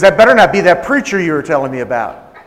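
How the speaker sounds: loud, indignant warning; hostile warning. Retold or imitated. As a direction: imitate an angry woman laying down a warning. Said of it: "That's how she said it".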